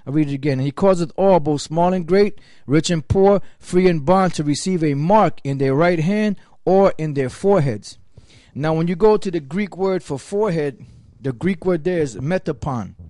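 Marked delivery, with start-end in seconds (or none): 0.5-8.0 louder, proclaiming Bible verse; scripture reading. Acted. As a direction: declaim the verse to the congregation.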